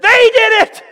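loud, high, panicked-sounding protest; blame-shifting shout. Acted. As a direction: shout the blame onto others. Acted blame-shifting by Saul.

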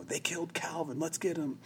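high, held-back mock yell; mock shout. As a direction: imitate soldiers shouting, without the volume. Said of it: "they're shouting".

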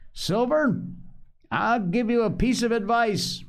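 high, carrying address; calling out. A narrator's reading. Doctor offers the cook advice, calling out high. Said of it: "he cried".

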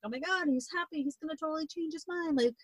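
high, hushed excited parent voice; lightly voiced overjoyed mother; giddy parents. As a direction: bubble over with hope, quietly.

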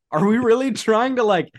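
fired up and exasperated; exasperated high-pitched protest; indignant rant. Real, not acted. Indignant rant about dumbing down.

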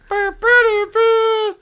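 high, loud lip buzz; buzzing noise. Retold or imitated. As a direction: imitate the buzz back. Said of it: "fucking stereo sound".

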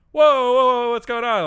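sudden high, loud alarmed cry; panicked whoa. Retold or imitated. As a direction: yelp it out in drunken panic. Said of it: "I'm starting to panic".

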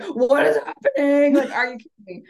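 imitating husband's shocked delight at the news; stunned husband. Husband sees Macy's card text.

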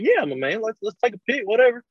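high breezy retold reply; mock casual self. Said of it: "I'm like".

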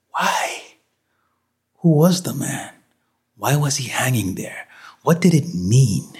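urgent, rattled self-questioning; distressed questioning. Acted. Shaken man questioning the sight of a hanging man, distressed.

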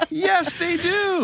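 said slowly and high through laughter; laughing. Real, not acted. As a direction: laugh while insisting it's true. Laughing, insisting.

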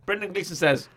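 ordinary talk, nothing marked

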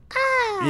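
high sharp gasp from juice; throat gasp. Retold or imitated. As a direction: yelp as the juice hits. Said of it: "your mouth goes".

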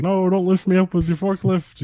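high, put-on voice for a scared pallet. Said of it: "They're like".

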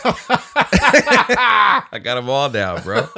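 laughing. Real, not acted. Laughing over his acting bit.

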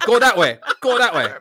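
loud, laughing mock yell; retelling a yelling scene, loud and giggly. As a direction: bark the directions while laughing. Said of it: "just yelling".

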